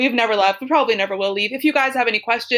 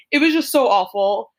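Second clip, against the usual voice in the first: usual speaking voice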